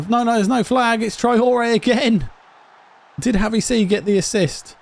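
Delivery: delighted shout as the goal stands; excited goal call. Real, not acted. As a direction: celebrate the goal, dragging it out. Celebrating a goal.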